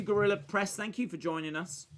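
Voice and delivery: usual speaking voice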